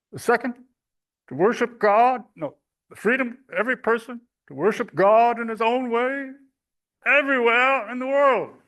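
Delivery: high, loud ringing orator voice; Roosevelt impression. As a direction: proclaim each freedom like FDR. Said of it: "I'll do the voice".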